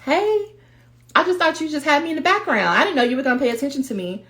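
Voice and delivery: high, quick, taken-aback little voice; surprised inner voice. Acted. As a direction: act startled at finally being noticed. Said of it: "It's like".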